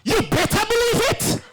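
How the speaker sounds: high, laughing aside; the MC laughs to the wedding crowd. Real, not acted.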